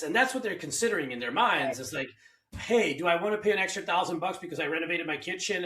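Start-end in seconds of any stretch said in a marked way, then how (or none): none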